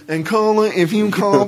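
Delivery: high, loud put-on radio voice; mock radio host. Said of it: "Like on the radio when they tell you".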